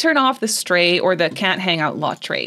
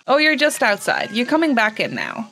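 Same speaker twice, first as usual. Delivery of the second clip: ordinary delivery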